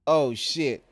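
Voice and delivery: shocked. Real, not acted. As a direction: really caught out, loud. Bigger surprised curse.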